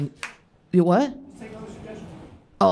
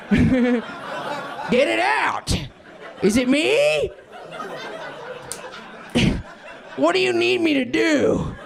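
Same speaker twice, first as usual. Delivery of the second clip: mock panic; 7 semitones higher, slower than usual. Retold panicked bedroom self.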